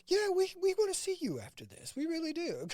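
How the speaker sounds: eager wide-eyed researcher. Retold or imitated.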